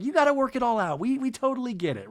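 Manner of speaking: high, placating retold reassurance; lightly voiced host. Winery owner's reassurance, acted.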